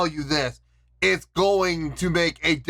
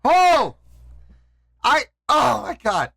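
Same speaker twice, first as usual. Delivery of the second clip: high, overwhelmed exclamations; out of control; 7 semitones higher, 4 dB louder than usual. Real, not acted. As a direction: burst out high, then start to falter. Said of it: "I'm out of control".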